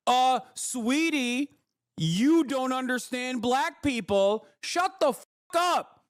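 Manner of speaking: high, slow, syrupy sneering voice; mock the know-it-all with sugary scorn; mocking condescending chatter. Streamer mimicking condescending chatters, slow high sneer. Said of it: "instantly being like".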